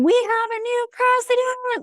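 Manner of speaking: high, drawn-out mock yell; imitated excited yell. Imitating a stranger yelling news outside the window, excited yell. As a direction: cheer it out high and slow, imitating a shouter. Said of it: "someone out my window yelling".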